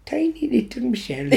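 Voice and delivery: lightly Irish, teasing a friend; Irish accent. Pretend. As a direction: coo it in a playful Irish accent. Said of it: "with your Irish accent".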